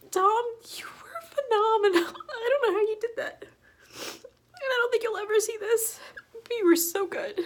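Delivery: real tears, pitch way up as she chokes; crying. Real, not acted. Vlogger tearfully thanking the actor directly, voice breaking with crying. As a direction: talk through sobs, voice breaking high.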